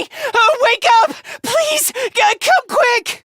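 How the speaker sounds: high, urgent cries for help; panicked pleading. Acted. Begging Takumi to wake and come, high panic. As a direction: plead frantically, pitched high.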